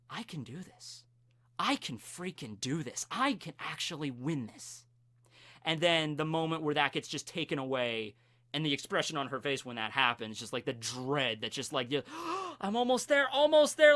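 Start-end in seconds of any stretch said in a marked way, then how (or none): 0.1-4.8 quiet, self-convincing thought; hushed inner resolve. Acted. Uraraka tells herself mid-battle she can win, hushed resolve. Acting out her hopeful thought. 12.1-12.6 sharp, quick intake of breath; acted gasp. Climber nearly at the ledge, sudden acted gasp. 12.6-13.9 high, urgent and breathless with hope; straining hope. Acted. Climber about to reach the ledge, acted straining excitement.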